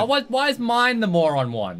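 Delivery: high, loud drawn-out protest; indignant complaint. Real, not acted. Player complaining about their moron ally, indignant. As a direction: wail it in indignation.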